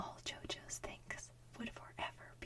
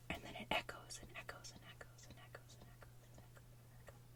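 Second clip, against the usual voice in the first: normal talking voice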